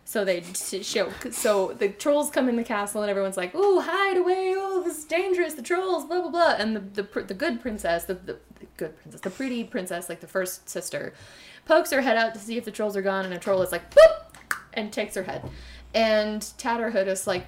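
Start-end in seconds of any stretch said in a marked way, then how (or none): 3.5-6.5 high, put-on frightened squeal; hammed-up fright of the castle folk; mock panic. Castle folk hiding from trolls, mock panic. 14.0-14.3 very high, loud comic boop; cartoon boop. Troll snatching the princess's head with a comic high boop.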